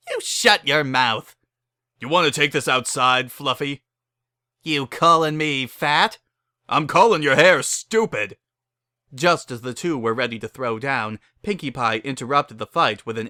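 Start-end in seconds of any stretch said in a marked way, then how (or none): 0.1-8.4 acted quarrel. Two ponies squaring off, mock angry. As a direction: trade hammed-up insults.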